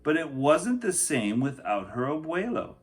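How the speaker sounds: put-on lively read-aloud for children; picture book reading. A narrator's reading. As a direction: tell the story to a class.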